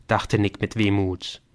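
plain reading voice

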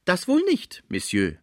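very high, insistent interruption; doorkeeper woman interjecting. A narrator's reading. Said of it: "fiel die Cibot ein". (Pförtnerin fällt ein, hohe Stimme)

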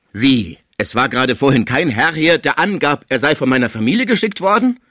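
loud, incredulous question, climbing in pitch; agitated protest. A narrator's reading. Patient insisting to his caretaker he saw visitors, agitated protest. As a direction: protest loudly, voice rising as you ask.

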